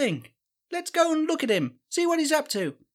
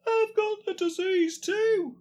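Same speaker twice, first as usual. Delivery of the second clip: put-on old wife voice; 6 semitones higher, slower than usual. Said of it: "Why does your accent keep changing?".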